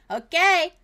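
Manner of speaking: a loud, high call through the door; called back. Acted. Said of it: "And she calls back".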